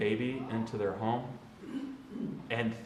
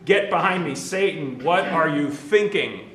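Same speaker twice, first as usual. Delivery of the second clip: loud, raised-pitch scolding; tough rebuke; 5 semitones higher, 8 dB louder than usual. Retold or imitated. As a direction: rebuke him forcefully. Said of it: "real rebuke. It's like a tough one".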